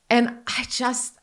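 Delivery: ordinary delivery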